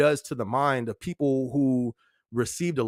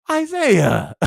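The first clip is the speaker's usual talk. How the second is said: higher, louder exclamation of the name, laughing; laughing call-out; 10 semitones higher, 6 dB louder than usual. Real, not acted. Streamer calls out chatter Isaiah, laughing in disbelief.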